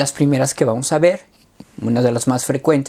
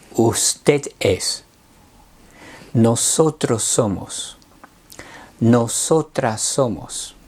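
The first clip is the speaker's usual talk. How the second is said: deliberate, spaced teacher recitation; slow classroom drill; slow conjugation drill; 2 semitones lower, much slower than usual. Shown as a demonstration. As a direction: set out each form slowly for learners to repeat.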